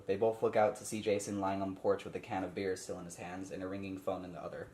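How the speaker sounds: ordinary talk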